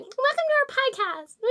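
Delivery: put-on squeaky high little-kid voice; chipmunk voice. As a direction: pitch it up like a chipmunk. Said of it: "a chipmunk voice 'cause I'm so little".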